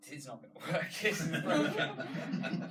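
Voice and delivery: laughing through the words; real laugh at the broken demo. Real, not acted. Live demo fails on stage.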